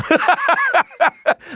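high, bright burst of real laughter. Real, not acted. Host laughs at saying badass.

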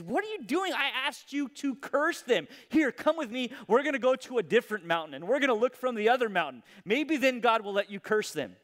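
high, frustrated demand, then rushing on; a king scolding the prophet who won't curse; exasperated king. Acted. Angry king confronting the prophet who blesses instead. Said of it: "And Balak says".

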